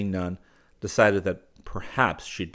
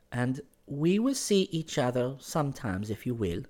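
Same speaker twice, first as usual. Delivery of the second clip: put-on higher, careful girl's voice; 6 semitones higher than usual. Girl offers to meet him sometimes, earnestly.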